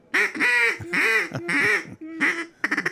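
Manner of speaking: sustained high duck-call honk. Shown as a demonstration. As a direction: blow a long honking duck call. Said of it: "give a duck call out".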